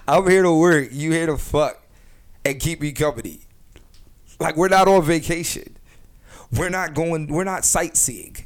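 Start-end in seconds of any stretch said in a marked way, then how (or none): 0.1-3.4 emphatic. Real, not acted. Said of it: "that's just the bottom line".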